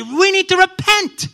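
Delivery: high, loud proclamation; emphatic call to repent. Acted. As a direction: cry it out like a prophet.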